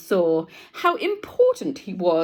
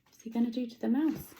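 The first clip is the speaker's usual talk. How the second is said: ordinary delivery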